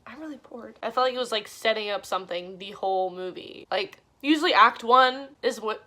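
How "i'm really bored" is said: bored murmur. Retold or imitated. Quoting her bored self.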